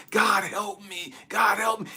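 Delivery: high, loud reenacted plea; crying out. Preacher acting out his cry to God for help, desperate. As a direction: call out desperately, stretching the words. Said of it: "I'm crying to God".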